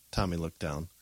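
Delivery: ordinary delivery, nothing marked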